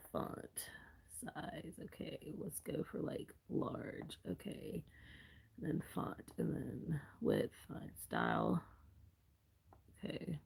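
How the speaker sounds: quiet whispered notes while filling in a form; whispering to herself. Real, not acted. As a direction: mutter the settings under your breath. Murmuring to herself while typing.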